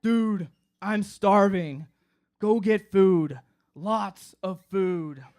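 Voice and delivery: very slow, heavy, lazy demand; stoned drawl. Retold or imitated. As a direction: drag every word out, half asleep. Stoned Ryan demanding food.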